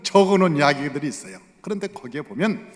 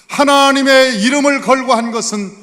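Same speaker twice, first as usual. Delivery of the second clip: preaching emphasis; 9 semitones higher, 12 dB louder than usual. Real, not acted. Preacher stresses the name of God.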